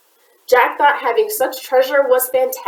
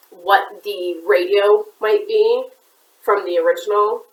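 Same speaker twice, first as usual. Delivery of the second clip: usual speaking voice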